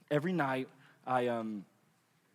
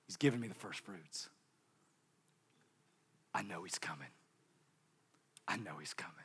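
whisper; 9 semitones lower, 14 dB quieter than usual. Acted. Preacher whispering conviction twice.

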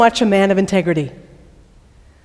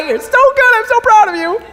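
much higher, playful praise; gushing praise; 13 semitones higher than usual. Real, not acted. Preacher praising a congregant who named Levi, much higher. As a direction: gush over the right answer.

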